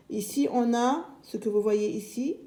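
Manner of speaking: ordinary talk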